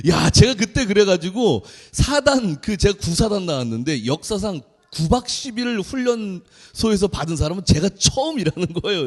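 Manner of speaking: ordinary talk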